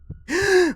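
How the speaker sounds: sharp high gasp for air; a high, shocked intake of breath; panicked gasp. Acted. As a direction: gasp as panic takes hold. Panic attack.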